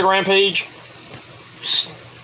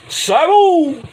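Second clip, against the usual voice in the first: high loud shout of the name; crowd-style name call; 11 semitones higher, 4 dB louder than usual. Acted. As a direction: yell it like a fan in the arena. Calling out wrestler's name.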